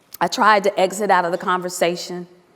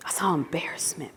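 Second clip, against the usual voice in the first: whispered, much softer; hushed whisper; 5 semitones lower, 13 dB quieter, faster than usual. Real, not acted. Speaker recalls the student's shame, whispered softly. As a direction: let it fall to a whisper.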